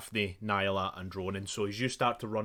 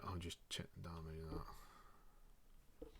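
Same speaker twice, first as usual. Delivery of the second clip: whispered under the breath, unvoiced; a quick hush while checking the dice; whispered aside; 6 semitones lower, 25 dB quieter, slower than usual. Real, not acted. Whispering to the players while rolling damage.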